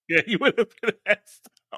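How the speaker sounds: high, laughing voice; laughing agreement. Real, not acted. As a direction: agree through a real laugh. Laughs while agreeing with co-host.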